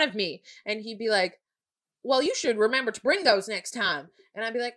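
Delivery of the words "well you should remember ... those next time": higher, louder Southern teacher; heavy Southern accent. Retold or imitated. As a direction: mimic an annoyed Southern teacher. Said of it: "heavy Southern accent too".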